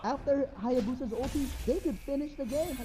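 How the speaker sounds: rising, excited esports casting; live play-by-play. Acted. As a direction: call the fight fast and hyped.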